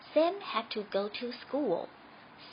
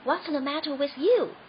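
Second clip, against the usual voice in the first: usual speaking voice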